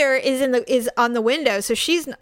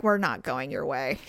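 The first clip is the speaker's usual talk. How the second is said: much lower and softer, icy dismissal; low cold retort; 10 semitones lower, 7 dB quieter than usual. Retold or imitated. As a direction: drop low and quiet to shut her down. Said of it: "I look at them and I'm like".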